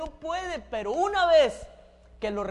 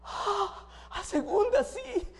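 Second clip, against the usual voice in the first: flustered learner; 9 semitones higher, slower than usual. Acted. Flustered student driver answers.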